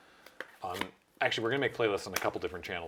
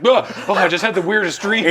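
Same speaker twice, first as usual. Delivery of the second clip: waking horse voice; 11 semitones higher, 15 dB louder than usual. Retold or imitated. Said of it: "a horse wakes up in bed going like".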